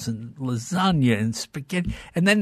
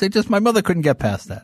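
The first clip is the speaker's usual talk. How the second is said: normal talking voice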